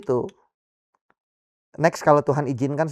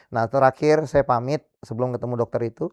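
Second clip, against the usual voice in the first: usual speaking voice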